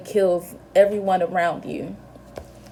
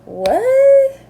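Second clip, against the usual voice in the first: high, loud incredulous what; shocked what; 8 dB louder than usual. Retold or imitated. Visitor hearing the mother forgive the killer, shrieking in disbelief.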